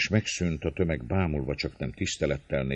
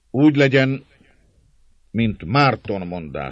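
leader's assent; 7 semitones higher, 9 dB louder than usual. A narrator's reading. Dózsa approving what Márton said, measured proclamation. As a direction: pronounce agreement as the one in command. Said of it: "szólt Dózsa".

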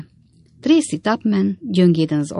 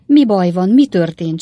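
louder, higher worried cry; mild alarmed shriek; 3 semitones higher, 7 dB louder than usual. A narrator's reading. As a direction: cry out in alarm, held back. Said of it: "sikoltozták a hölgyek". (visszafogott, riadt sikoltás)

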